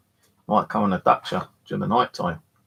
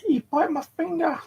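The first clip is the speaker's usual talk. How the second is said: much higher, still shaken from the pain; startled protest. Real, not acted. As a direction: blurt it out high and startled. Right after the bite.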